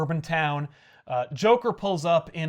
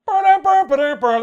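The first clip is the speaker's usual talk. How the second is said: high, loud ba-dum beats that fade; loud comic-book heartbeat that fades out; heartbeat drum sound; 10 semitones higher, 11 dB louder, faster than usual. Retold or imitated. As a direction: mimic a dramatic heartbeat sting, dying away. Dramatic beat ending the issue.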